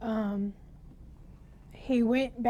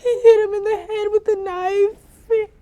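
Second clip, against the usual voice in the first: real sobbing, pitched high and louder; crying; 7 semitones higher, 8 dB louder than usual. Real, not acted. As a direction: break down crying while telling it. Survivor retelling brother's killing.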